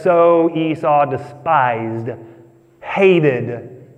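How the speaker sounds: slow, each verb weighed; slow weighty reading. Shown as a demonstration. Preacher reading the verdict on Esau, slow and heavy. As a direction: lean on despised and hated. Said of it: "it says".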